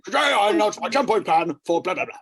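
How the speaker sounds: hammed-up Boris Johnson press statement; Boris Johnson impression. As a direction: announce it like a bumbling posh PM. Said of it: "easier to do an impression of him".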